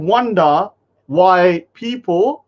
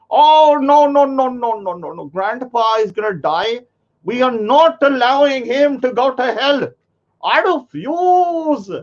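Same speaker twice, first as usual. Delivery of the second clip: mocking the grandson; 8 semitones higher than usual.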